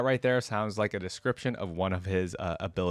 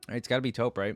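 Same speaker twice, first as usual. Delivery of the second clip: plain reading voice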